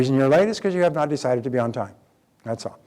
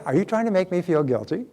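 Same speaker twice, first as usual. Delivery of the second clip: higher, lilting cheerful question; 4 semitones higher than usual. Shown as a demonstration. Asking mother about the guilt trip, cheerful. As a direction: ask brightly, with a rise at the end. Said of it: "just say it with a cheerful voice".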